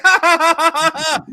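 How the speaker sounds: high, loud hammed-up fake laughing; mock laughter. Old war buddies on a podcast, hammed-up fake laughing. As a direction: ham up a fake guffaw with the guys. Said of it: "we're like".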